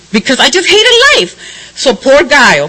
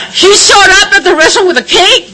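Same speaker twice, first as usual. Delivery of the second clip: laughing; 6 semitones higher than usual. Real, not acted. Laughing at birthday prank.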